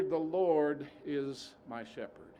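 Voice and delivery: \"The Lord is my shepherd\" drawn out slowly, leaning on Lord; slow, weighty quote. Shown as a demonstration. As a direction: savour each word slowly. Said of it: "He is. Not was".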